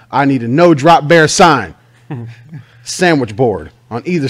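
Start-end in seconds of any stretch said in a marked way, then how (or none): 0.1-1.7 mock order. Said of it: "You tell him".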